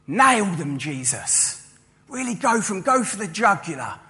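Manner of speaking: a touch of a crowd egging Jesus on; eager heckling. Retold or imitated. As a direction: urge him on to go for the kill.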